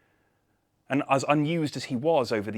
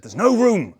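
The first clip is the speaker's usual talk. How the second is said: curt innkeeper; 10 semitones higher, 8 dB louder than usual. Acted. Innkeeper turns him away.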